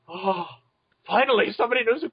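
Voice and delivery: gasp, then exclaim it high; excited relief. Retold or imitated. Recalling finally meeting a fellow fan, excited relief.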